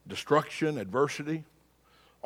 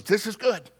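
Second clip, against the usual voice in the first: higher, cheerful servant's catchphrase; annoyingly positive servant; 5 semitones higher than usual. Acted. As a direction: play the relentlessly upbeat servant. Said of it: "annoyingly positive".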